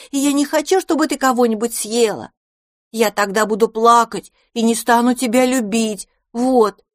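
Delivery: put-on high, eager little child voice; little boy voice. A narrator's reading. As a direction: speak like a small pleading boy.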